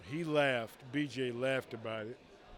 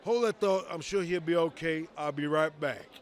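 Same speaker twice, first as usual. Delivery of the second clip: louder, higher, in a rush to leave; a lightly hammed-up quick excuse; mock hurried brush-off; 3 semitones higher, 7 dB louder than usual. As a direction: hurry him off, half joking. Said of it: "I kept repeating to myself".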